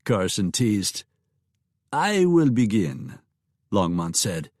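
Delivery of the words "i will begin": put-on slow, polite English with a light French accent; a hint of a Frenchman speaking English; lightly French-accented host. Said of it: "With your accent".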